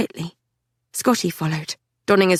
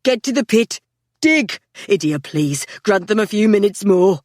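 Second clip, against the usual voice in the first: shouting. A narrator's reading. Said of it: "shouting to one another".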